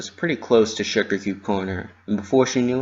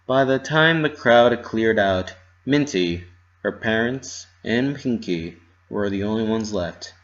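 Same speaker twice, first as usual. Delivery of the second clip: ordinary delivery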